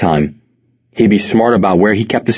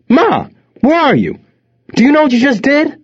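angry shouting. A narrator's reading. Son calling out for his mother, angry shout. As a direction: holler for someone in another room, furious. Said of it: "he shouted".

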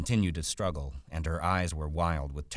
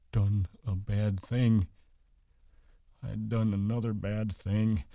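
slow, higher, strained voice, not truly whispered; frightened hushed dread; 5 semitones higher, slower than usual. A narrator's reading. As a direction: say it slowly, stunned with fright. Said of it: "he whispered in fright".

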